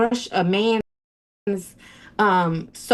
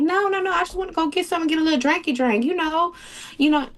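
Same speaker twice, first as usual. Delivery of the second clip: breezy cover-up; 7 semitones higher, faster than usual. Retold or imitated. Playing casual to get car keys.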